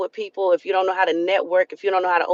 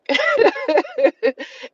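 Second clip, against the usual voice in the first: real laugh breaking out high; bright burst of laughing; high burst of laughter. Real, not acted. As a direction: laugh at herself. Laughing after New York claim.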